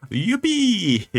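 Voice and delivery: loud, high Yippee; cheering. Acted. Character cheers loudly.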